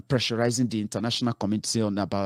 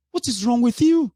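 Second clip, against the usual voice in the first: much higher, louder outburst; exasperated shout; 13 semitones higher, 5 dB louder than usual. Real, not acted. Speaker scolding squabbling followers, shouting in exasperation. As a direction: yell it at them in disbelief. Scolding his listeners, repeated.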